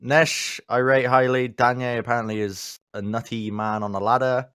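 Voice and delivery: usual speaking voice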